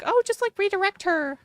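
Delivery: sing-song parody of glib advice; mocking advice.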